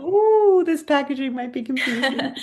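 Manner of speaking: high, drawn-out ooh of concern; playful high-pitched retold worry; mock dawning alarm. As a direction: voice a worried reaction up high. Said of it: "I was like".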